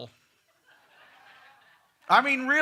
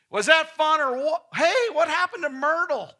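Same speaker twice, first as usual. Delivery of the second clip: louder, higher, hearty pilot shout; loud call back; 8 semitones higher, 9 dB louder than usual. Retold or imitated. Pilot calling back to passengers after landing, loud. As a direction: call over the engine noise.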